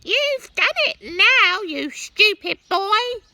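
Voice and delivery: put-on very high, loud old-woman shriek; shouting Grandma. A narrator's reading. Grandma yelling down at George from the roof. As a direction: screech it at the boy like a cross old granny. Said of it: "Grandma shouted".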